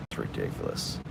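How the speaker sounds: whispered under the breath; whispering. Real, not acted. Repeats complaint under her breath.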